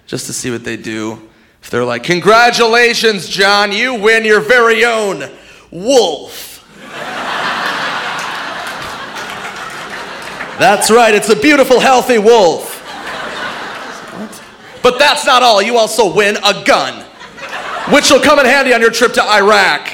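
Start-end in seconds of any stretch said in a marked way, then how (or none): 2.0-6.6 high, slow game show announcer; hammed-up prize announcer; booming host. 10.6-19.9 hammed-up prize announcer; booming host. Host continues prize pitch.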